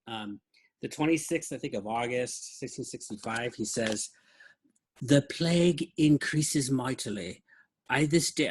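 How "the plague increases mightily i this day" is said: put-on terrible English accent. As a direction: ham up a bad British accent while reading. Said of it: "I'm doing a terrible English accent".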